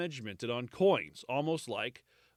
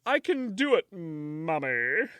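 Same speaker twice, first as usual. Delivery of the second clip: put-on whiny boy Nero; 5 semitones higher, slower than usual. Nero insisting to his mother, whiny and high. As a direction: beg like a spoiled kid. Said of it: "He's like".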